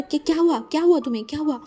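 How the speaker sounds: alarmed uncle demanding what happened; panicked. A narrator's reading. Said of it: "uncle इतना ज़्यादा घबरा गया था". (घबराया हुआ ऊँची आवाज़ में सवाल)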